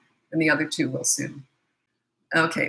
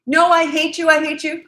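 high, loud mock child yelling; angry child; 10 semitones higher, 7 dB louder than usual. Nine-year-old daughter screaming at her parent, mock child yell. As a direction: yell it like a furious little kid.

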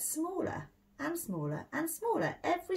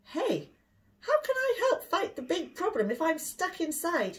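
put-on higher, louder little boy's voice; boy's protest; 9 semitones higher, 3 dB louder than usual. Boy protesting to his mummy about the locked door, higher and louder. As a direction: plead like a kid stuck indoors. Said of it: "he asked".

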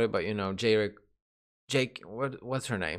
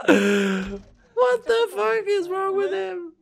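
high, slow squealing disbelief; excited squeal; much slower than usual. Real, not acted. Fan squealing at a video of him. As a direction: squeal it out, stretched and amazed.

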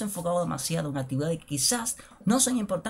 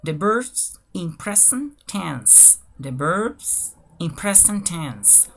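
very drawn-out careful model phrase; slow demonstration; much slower than usual. Shown as a demonstration. Teacher reading a page title to learners, very slow and deliberate. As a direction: break the phrase into slow, separate chunks. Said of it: "lo voy a hacer de manera lenta y despacio".

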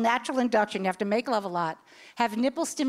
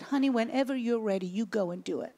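very calm; 2 semitones lower, 5 dB quieter than usual. Retold or imitated. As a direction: gently soothe the boy, quietly. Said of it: "very slow, very calmly".

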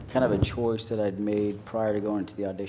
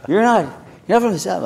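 higher and louder, playing a stunned fan; mock surprised fan; 10 semitones higher, 10 dB louder than usual. Fan at the airport, shocked he's not Texan, surprised. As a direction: pop up high and loud in disbelief. Fans meeting actor at airport.

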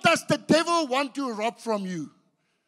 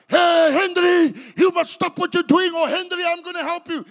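mock shout; 9 semitones higher, 11 dB louder than usual. How we want God to speak, loud shout. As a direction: bellow it like a voice from the thunder.

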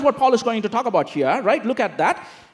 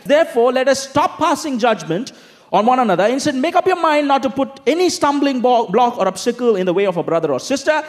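scripture reading; 4 semitones higher, 3 dB louder than usual. Acted. Reads scripture passage aloud.

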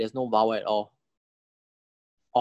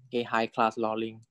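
ordinary talk, nothing marked

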